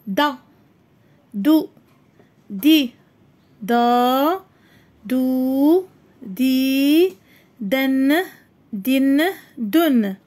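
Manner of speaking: dal with each vowel sound slowly and clearly; letter drills. Shown as a demonstration. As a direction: pronounce دو دي دا one by one like a teacher. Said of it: "إذن حرف الدال".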